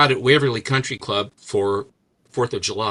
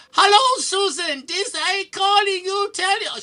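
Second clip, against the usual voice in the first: piercing high falsetto greeting; hammed-up squeaky foreign caller; mocked telemarketer. Cold caller greeting Susan, high mocking falsetto. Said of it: "when I answer the phone".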